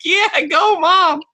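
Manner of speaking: high, loud cheer; cheering on a mother, high and loud. Retold or imitated. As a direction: cheer the mom on. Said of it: "I'm like".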